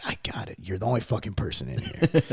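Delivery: barely voiced, hushed reply; retold whisper. The clerk quietly agreeing with him, in a hushed whisper.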